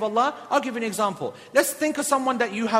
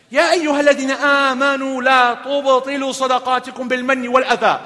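louder, chanted Arabic recitation with a changed voice; Quran recitation; 2 semitones higher, 6 dB louder than usual. Acted. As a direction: intone the verse as in prayer.

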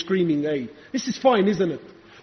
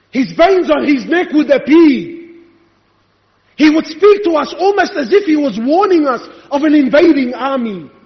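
insistent sermon, raised on key phrases; fervent preacher citing proof; impassioned preaching; 6 semitones higher, 6 dB louder than usual. Real, not acted. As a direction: press the evidence on the congregation. Preaches at full force.

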